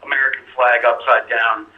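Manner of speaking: caller's voice through a phone line; over a landline phone. Real, not acted. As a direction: sound like a call on a landline. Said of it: "I'm on the landline".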